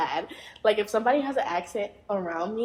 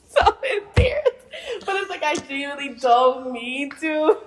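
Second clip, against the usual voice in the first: high, loud, laughing confession; real embarrassed giggles through the words; 5 semitones higher, 6 dB louder than usual. Real, not acted. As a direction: laugh helplessly while explaining yourself. Embarrassed laughter about her mimicry.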